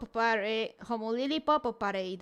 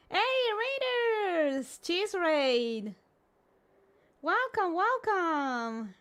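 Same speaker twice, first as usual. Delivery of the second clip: cute anime streamer; 5 semitones higher, much slower than usual. Acted. Streamer greeting chat cutely.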